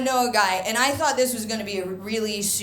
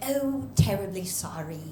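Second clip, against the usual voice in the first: mock-polite English accent; an American's attempt at sounding English; put-on English accent. Comedian answers border agent, putting on an English accent. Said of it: "answer her in a more English way".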